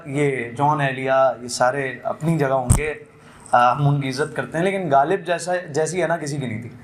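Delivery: normal talking voice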